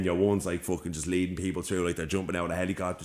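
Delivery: ordinary delivery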